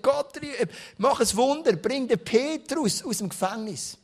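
imitated urgent prayer. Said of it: "hat die ganze Nacht gebetet".

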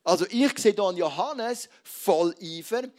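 ordinary delivery

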